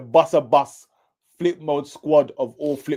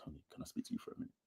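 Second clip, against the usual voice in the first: fast hushed whisper; discreet whisper; 9 semitones lower, 25 dB quieter, much faster than usual. Retold or imitated. Friend showing how to pull Tony aside, fast whisper. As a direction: slip the question in quietly. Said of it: "you'll be like".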